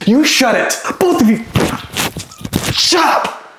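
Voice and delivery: angry shout. Acted. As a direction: silence them all angrily. Shouts kits down.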